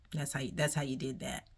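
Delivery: usual speaking voice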